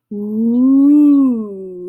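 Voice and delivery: spooky ghostly ooh, long and loud. Acted. Booger teasing Lisa, in a spooky ghost voice. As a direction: moan it like a ghost. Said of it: "he said in a ghost voice".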